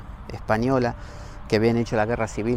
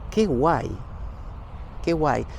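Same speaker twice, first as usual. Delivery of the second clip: mild mock gushing; 3 semitones higher, slower than usual. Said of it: "y digo".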